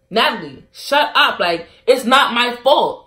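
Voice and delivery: hammed-up defensive outburst; imitating angry retort. Friend snaps during argument.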